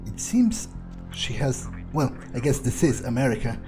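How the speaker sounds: ordinary delivery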